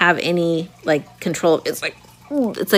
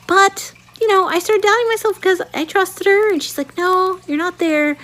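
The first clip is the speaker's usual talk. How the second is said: high-pitched; 12 semitones higher than usual. Retold or imitated. Doubting, nurse dismisses her.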